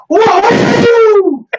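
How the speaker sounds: high, loud celebratory whoop; excited whoop. Real, not acted. Host cheering at the interview's end, a loud high whoop.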